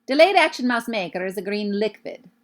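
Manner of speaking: liquid said as LIK-vid; witch's accent. A narrator's reading. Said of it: "liquid is how she says it".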